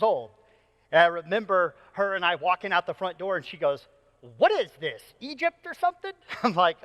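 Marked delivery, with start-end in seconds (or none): 4.4-6.1 imitating an incredulous child, rising high at the end; mock-baffled little girl's quip; incredulous kid. Daughter to dad on the frog-covered porch, incredulous.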